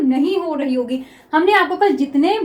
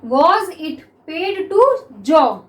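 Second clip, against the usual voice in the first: very slow, each word held; slow dictation reading; much slower than usual. Shown as a demonstration. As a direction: dictate the phrase slowly. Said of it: "next देखिए".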